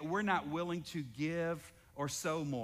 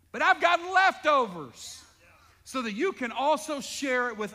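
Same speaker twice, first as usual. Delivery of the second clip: higher, louder preaching cry; full-force preaching; 7 semitones higher, 8 dB louder than usual. Acted. Pastor voicing God's promise, loud preaching.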